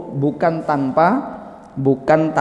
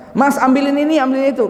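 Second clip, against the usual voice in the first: high-pitched calling-out to husband; high, demanding mock call; mock yell from kitchen; 10 semitones higher, faster than usual. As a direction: imitate a wife ordering from another room. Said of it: "istrinya teriak dari dapur".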